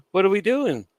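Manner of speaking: high, loud cry of what are we doing; exasperated disbelief. Retold or imitated. Laughing at the Bloodline breaking character, in high disbelief. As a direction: throw it up in mock bewilderment.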